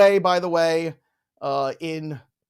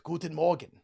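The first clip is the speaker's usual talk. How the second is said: normal talking voice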